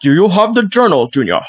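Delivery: hammed-up Scottish movie-dad voice; put-on Scottish actor accent; Sean Connery. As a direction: play a Scottish father addressing his son. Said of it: "You always do Indiana Jones, Sean Connery".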